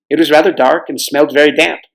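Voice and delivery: ordinary delivery, nothing marked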